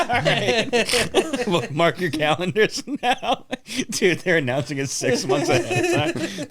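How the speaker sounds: high, cracking-up disbelief; incredulous laughing. Real, not acted. Comic mocking a far-off show date, high incredulous laughter. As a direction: laugh all through it, pitch way up.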